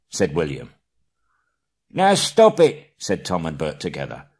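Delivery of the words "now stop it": much higher, louder slow shout. Acted. Tom and Bert shouting together, high and loud. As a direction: stop the quarrel.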